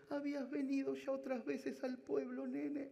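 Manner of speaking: high, mock-tearful old mother; torn voice. Mother asking her son whether he came before, mock-torn voice. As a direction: imitate a heartbroken old mother. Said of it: "Me lo decía con la voz desgarrada".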